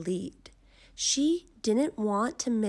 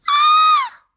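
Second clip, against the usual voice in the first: piercing high scream of shock; girl screams at her striped reflection; 16 dB louder than usual. A narrator's reading. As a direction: shriek in horror at the mirror. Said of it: "Then she screamed".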